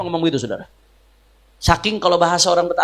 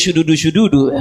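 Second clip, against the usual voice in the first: Habib Bagir impression; 4 semitones lower, 4 dB louder than usual. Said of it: "Emang Habib Bagir doang yang bisa begitu?".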